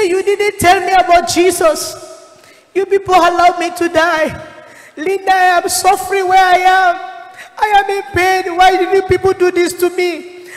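dead friend accusing Linda from the afterlife, crying reproach; crying accusation. Retold or imitated. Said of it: "as he was crying towards me".

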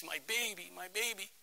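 hushed, tearful mother's cooing; very soft mock sob, full of relief; imitated sobbing. Mother hugging her returned child, softly imitated sobbing.

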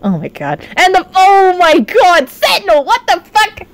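normal talking voice

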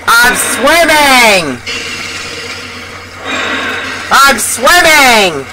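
high, stretched-out cry that falls away; amazed exclamation. Real, not acted. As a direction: swoop up on swimming, drawing it out. Glitched into swimming, exclaims repeatedly.

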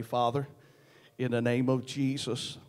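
normal talking voice

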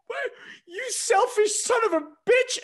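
loud, high-pitched mock wail; hammed-up, drawn-out sobbing screech; imitated bawling. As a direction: bawl the insult as an exaggerated crying wife. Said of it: "oh, bawling. She was".

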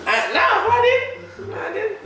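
high nervous laugh; nervous laughing denial. Real, not acted. Nervous before tasting.

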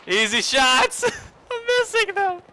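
loud, very high squeal, breaking into laughter; exasperated shriek. Real, not acted. Gamer to himself over missed easy shots, exasperated shriek.

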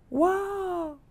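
high, hushed gasp of wonder; amazed wow. Acted. Jenny seeing her birthday present, softly amazed. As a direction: breathe out a delighted wow.

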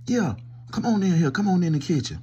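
usual speaking voice

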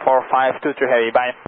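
usual speaking voice

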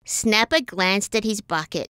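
usual speaking voice

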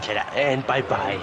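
usual speaking voice

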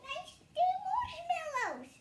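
high, drawn-out protest; stubborn high-pitched refusal; defiant whine. Acted. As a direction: dig in with a long, high whine. Child refuses to give up marshmallows.

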